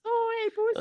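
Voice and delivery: very high falsetto coo to a pet; pet talk. Real, not acted. Podcaster greeting the cat that comes in, high-pitched pet talk.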